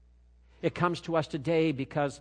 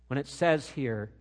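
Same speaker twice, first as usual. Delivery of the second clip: ordinary talk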